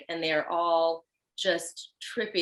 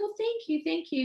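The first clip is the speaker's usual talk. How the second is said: much higher, gushing thanks; sweet high thank you; 10 semitones higher than usual. Retold or imitated. Thanking the stranger on the Brooklyn street, high and sweet. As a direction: play it up, bright and flattered. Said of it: "I was like".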